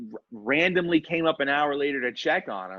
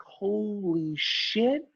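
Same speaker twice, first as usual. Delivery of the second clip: very slow, higher, rising; stunned disbelief; 6 semitones higher, much slower than usual. Retold or imitated. Guest turning to the security guards, stunned. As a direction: draw it out in amazement.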